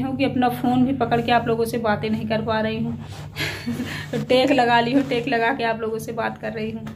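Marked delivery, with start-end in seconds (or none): 3.0-4.1 short soft breathy laugh. Real, not acted. A soft breathy laugh to viewers about not being able to hold the phone. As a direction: laugh softly at your own struggle.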